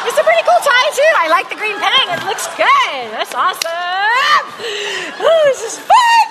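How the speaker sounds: over-the-top excited welcome, laughing between lines; bubbly sapphire greeting. Acted. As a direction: greet him like a long-lost best friend, squealing with delight. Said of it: "the Sapphires don't know why".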